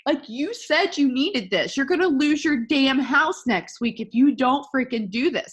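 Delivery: impassioned scolding, pushed and emphatic; heated tough love. Real, not acted. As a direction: lay into them to get them moving. Tough-love rant at listeners.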